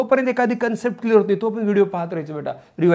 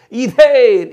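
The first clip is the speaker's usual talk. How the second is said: Ethane loud and high, falling at the end; punchy classroom call; shouted drill; 4 semitones higher, 10 dB louder, much slower than usual. Shown as a demonstration. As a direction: belt out ethane to lead a chant. Chants alkane against alkyl name.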